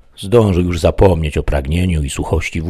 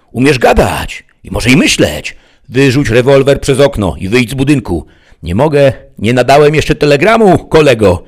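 high, loud calling; shouted exchange; 9 semitones higher, 8 dB louder than usual. A narrator's reading. Sheriff and bandit bargain through walls, shouting. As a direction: trade shouts across a distance.

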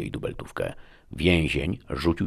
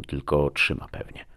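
plain reading voice

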